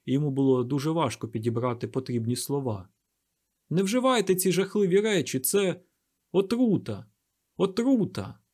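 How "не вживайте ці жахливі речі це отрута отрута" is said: high-pitched, urgent plea; impassioned raised-voice appeal; urgent warning. A narrator's reading. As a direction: warn the crowd about a danger. Savage pleads with crowd.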